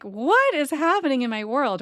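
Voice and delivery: disbelief. Retold or imitated. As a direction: ask it in stunned disbelief. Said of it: "And I'm just like".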